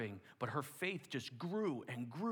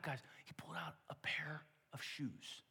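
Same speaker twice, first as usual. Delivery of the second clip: hushed reveal; 5 dB quieter than usual. Acted. Climax of the gift story.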